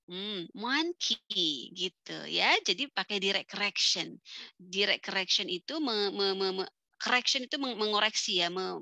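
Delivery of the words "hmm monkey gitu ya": ordinary talk, nothing marked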